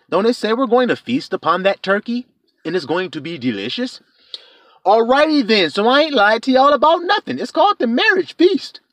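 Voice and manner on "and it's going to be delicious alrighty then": ordinary delivery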